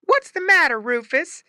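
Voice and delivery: high, loud calling wife's voice; wife calling across the yard; farmer's wife. A narrator's reading. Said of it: "asked the farmer's wife".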